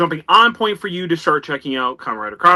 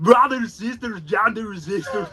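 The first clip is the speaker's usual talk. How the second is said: put-on slow Austrian action-hero accent; drawn-out bodybuilder rally greeting; Arnold Schwarzenegger impression; 2 semitones higher, slower than usual. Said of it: "Arnie did that introduction".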